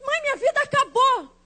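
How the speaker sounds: high-pitched, hammed-up despair; dramatic lament. Daughter telling mom her life is over, mock despair. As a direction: wail lightly that all is lost.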